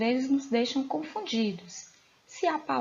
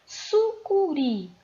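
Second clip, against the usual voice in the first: "Sucuri" slow and higher, syllables stretched; syllable-by-syllable demonstration; 5 semitones higher, much slower than usual. Shown as a demonstration. Teacher giving pupils the example "Sucuri" to find the tonic syllable, slowly sounded out. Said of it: "Qual é a sílaba tônica?".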